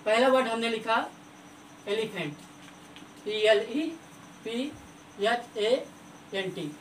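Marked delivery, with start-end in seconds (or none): 1.9-6.7 quiet, spelled-out "elephant" letter by letter; softly spelling out. Shown as a demonstration. Teacher spelling a word for children, softly. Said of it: "हमने example लिखा ई से".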